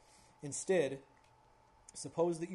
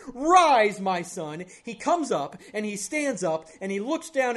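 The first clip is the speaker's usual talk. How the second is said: loud, high, commanding sermon climax; impassioned preaching; 7 semitones higher, 11 dB louder than usual. Real, not acted. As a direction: proclaim it with full force to the congregation. Preaches the story's climax forcefully.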